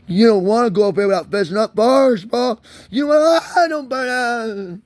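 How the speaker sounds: high, rising wail into a long held cry; wailing warning. Acted. Warning a cop to confess before judgement, high drawn-out wail.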